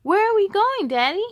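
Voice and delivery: put-on high, loud little boy; curious child. A narrator's reading. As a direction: ask like an eager kid. Child asks from car.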